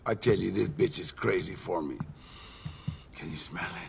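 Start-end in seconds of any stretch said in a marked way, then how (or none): 3.2-3.9 hushed, breathy leer; a low conspiratorial whisper; sly whisper. Acted. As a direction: whisper it like a dirty secret. Leering boast to his buddy.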